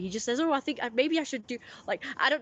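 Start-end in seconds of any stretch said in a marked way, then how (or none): 0.4-1.6 higher-pitched mock hesitation; put-on tentative, pitched-up dithering; imitated unsureness. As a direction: imitate someone who can't commit. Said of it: "it's like an unsure thing".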